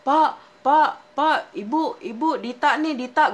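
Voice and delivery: loud high repeated call. Retold or imitated. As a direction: shout for them to come out. Calling parents from outside.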